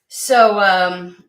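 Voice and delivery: ordinary talk, nothing marked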